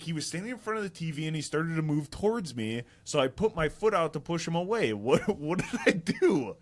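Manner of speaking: high-pitched, acted self-defence; retold indignant protest. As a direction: plead your innocence, playing it up. Defends himself to angry mom, retold.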